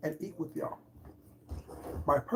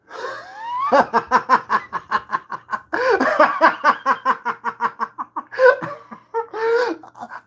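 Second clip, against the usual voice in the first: big laugh; 8 dB louder than usual. Real, not acted. Laughs after the parody.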